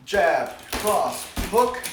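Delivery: jab... cross... hook, each loud and separate; slowed down. Shown as a demonstration. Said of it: "Let me slow it down again".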